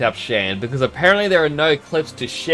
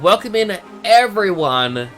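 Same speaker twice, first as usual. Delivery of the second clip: excited welcome; 8 semitones higher, 4 dB louder than usual. Real, not acted. Welcoming everyone to the stream.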